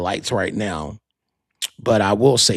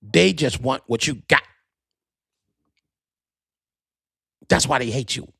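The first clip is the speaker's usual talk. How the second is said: lightly voiced cousin; understated impression of T T; imitating cousin T T. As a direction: mimic the cousin's measured warning. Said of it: "He talk like that".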